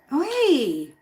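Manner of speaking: long, high, loud "oi"; calling out. Real, not acted. Owner hearing cats fight behind her, high warning call. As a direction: holler a drawn-out warning at the pets.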